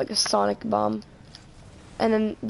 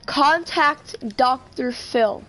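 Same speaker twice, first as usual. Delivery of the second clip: slow voice command; 2 semitones higher, 3 dB louder, much slower than usual. Pretend. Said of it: "Hey Siri".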